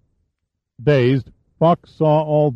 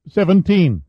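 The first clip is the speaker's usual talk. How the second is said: put-on higher boy's voice saying Seventeen; adolescent voice; 8 semitones higher, 3 dB louder than usual. A narrator's reading. Teenage sailor tells the captain his age: Seventeen, in a higher boy's voice. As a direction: answer Seventeen like a young teenage boy. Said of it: "The voice had an adolescent pitch".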